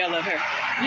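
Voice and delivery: ordinary talk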